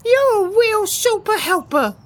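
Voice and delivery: high happy chick cheer; cheerful chick voices. Acted.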